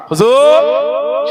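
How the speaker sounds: long drawn-out cry, high and loud; pleading call. Acted. Pilgrim calling to the Prophet at his tomb, drawn-out plea. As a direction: call out and stretch the word right out.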